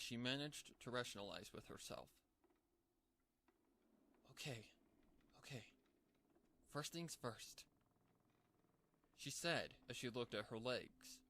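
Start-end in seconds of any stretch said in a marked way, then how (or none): 4.3-7.6 high, softer pony voice settling; lightly voiced cartoon pony; pony regaining composure. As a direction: gather yourself, quietly. Pinkie collecting herself.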